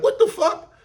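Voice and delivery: high-pitched, incredulous exclamation; incredulous outburst. Real, not acted. Commentator baffled that a man would snitch, incredulous. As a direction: pitch shooting up in disbelief. Ranting about the alleged snitching.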